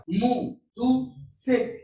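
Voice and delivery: each syllable مو، تو، سك said very slowly and apart; slow syllable drill. Shown as a demonstration. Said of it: "كلمات من ثلاث ومن أربع مقاطع". (تقطيع المقاطع ببطء شديد)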